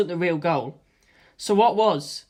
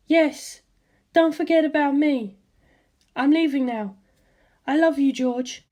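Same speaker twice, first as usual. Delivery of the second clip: higher, tender mother; 8 semitones higher than usual. A narrator's reading. Mother encourages her son before his battle, tender. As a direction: reassure your son like a mother.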